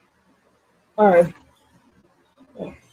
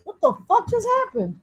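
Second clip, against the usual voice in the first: ordinary delivery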